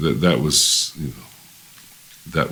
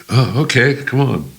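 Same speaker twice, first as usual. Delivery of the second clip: retold eager agreement; 10 semitones higher, 4 dB louder than usual. Acting out Hamby's reply.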